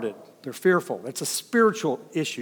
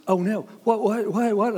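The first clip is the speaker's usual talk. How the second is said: high, louder panicked gasp and stammer; gasp, then blurt what what what in alarm; a frightened traveller's mock panic, pitched up; 8 semitones higher, 4 dB louder than usual. Frightened woman's panicked reply.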